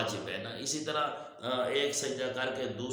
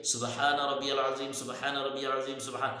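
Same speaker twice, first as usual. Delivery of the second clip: سبحان ربی العظیم run together in a single breath; rushed in one breath. Shown as a demonstration. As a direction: gabble it with no pause between. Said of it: "ایک سانس میں نہیں پڑھنا". (ایک سانس میں جلدی جلدی)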